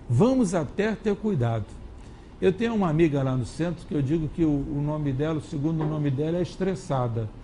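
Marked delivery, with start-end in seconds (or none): none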